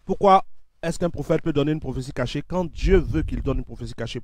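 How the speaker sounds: normal talking voice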